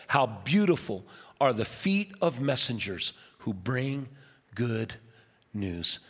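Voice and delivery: slow, fading softly to a hush on the last word; tender scripture reading. Real, not acted.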